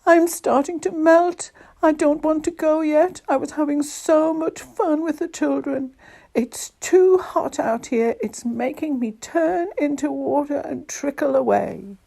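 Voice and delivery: high, loud snowman lament; woeful storybook wail, easing toward the end. A narrator's reading. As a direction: bewail your own melting. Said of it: "wailed the snowman".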